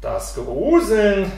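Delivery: drawn-out word with a falling end; mildly weary, long-stretched answer; sighing reply. A narrator's reading. Father answers his foolish son about shuddering, with a mild sigh. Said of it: "Der Vater seufzte und antwortete".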